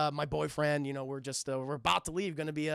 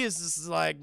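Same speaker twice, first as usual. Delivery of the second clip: ordinary talk, nothing marked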